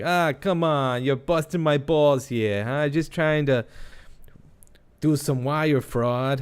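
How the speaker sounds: a Boston wiseguy complaining he's being hassled; hammy Boston gangster accent. Retold or imitated. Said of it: "I can't do the Boston accent".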